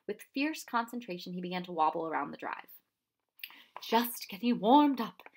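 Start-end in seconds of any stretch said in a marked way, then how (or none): 3.8-5.3 slow, louder, higher grinning announcement; announced with a grin. A narrator's reading. Professor tells the children while wobbling on the bike, grinning. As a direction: proudly declare it, grinning. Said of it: "he announced with a grin".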